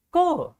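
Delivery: Co high and falling, no P or S; correct pronunciation. Shown as a demonstration. Teacher repeats the word alone, high Co with silent endings. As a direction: isolate Co as a single open syllable. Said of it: "both letters are silent".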